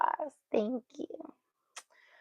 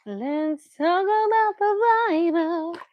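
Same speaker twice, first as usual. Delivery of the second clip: singsong; 6 semitones higher, slower than usual. Real, not acted. Mom singsonging to her baby about reading the Bible.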